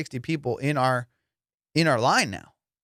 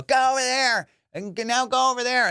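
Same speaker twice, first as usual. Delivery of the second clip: high, bossy mock order; shrill-free high pitched lady barking directions; 11 semitones higher, 3 dB louder than usual. Staff lady ordering the crowd around, high mock command. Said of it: "some lady would come out and go".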